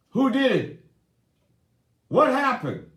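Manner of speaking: high, loud, urgent demands of who and what; demanding questions. Retold or imitated. Husband to crying wife, loud urgent demands. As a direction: fire off questions like a worried husband.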